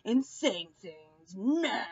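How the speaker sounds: hammed-up Paul calling Dominique a liar; mocking Paul. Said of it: "he was like".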